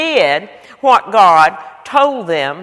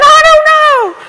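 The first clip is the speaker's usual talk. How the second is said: mock panic; 8 dB louder than usual. Narrator answers in panic.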